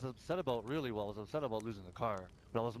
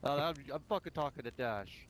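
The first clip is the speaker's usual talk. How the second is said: ordinary delivery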